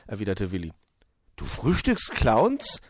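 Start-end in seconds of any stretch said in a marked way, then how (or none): 1.4-2.8 high, slow astonished question; baffled. A narrator's reading. Naive Borstel takes a joke literally, baffled. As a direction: draw it out in disbelief, voice rising. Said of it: "war Borstel verblüfft".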